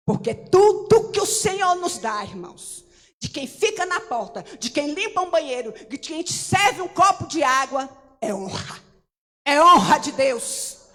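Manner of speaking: loud, slow, shouting preacher; full-force Pentecostal exhortation; fervent preaching. Acted. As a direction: preach it out to the church.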